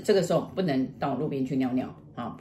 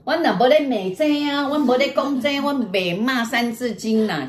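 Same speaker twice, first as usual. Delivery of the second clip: higher-pitched mock-indignant denial; imitated protest; 6 semitones higher, 3 dB louder than usual. Father denying he swore, imitated protest. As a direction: protest innocence as the accused husband. Husband denies teaching swear words.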